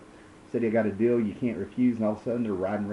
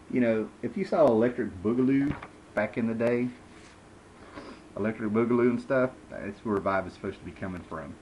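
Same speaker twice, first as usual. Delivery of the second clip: ordinary talk, nothing marked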